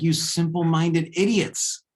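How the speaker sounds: loud, furious rant at fools; angry yell. Acted. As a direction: scream it down at the simple-minded crowd. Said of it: "he screams and he's like".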